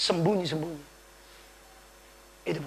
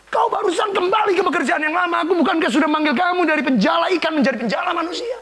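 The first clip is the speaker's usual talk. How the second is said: scolding shout; 11 semitones higher, 6 dB louder than usual. Acted. Preacher voices scolding Lord, not real.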